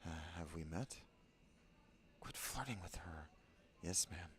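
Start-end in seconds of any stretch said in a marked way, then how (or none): 2.2-3.3 hushed scolding whisper. Acted. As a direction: hiss it under your breath. Aside to companion, hushed.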